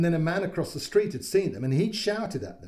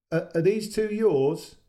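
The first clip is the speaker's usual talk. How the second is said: higher, louder, slow friendly call; friendly neighbour; 5 semitones higher, 6 dB louder, slower than usual. A narrator's reading. Neighbour calling across to the mother, friendly and louder. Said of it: "His tone was friendly".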